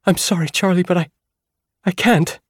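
high, loud and breaking; panicked apology. Acted. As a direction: stammer it out in panic. Eris panicking, fleeing.

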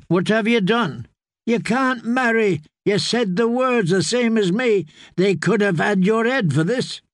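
high, urgent reproach; dismayed scolding Sam. Acted. Sam confronts the sworn brother who married, high dismayed reproach. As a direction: scold a friend in alarmed disbelief.

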